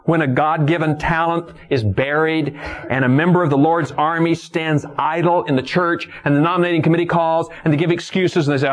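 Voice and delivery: ordinary delivery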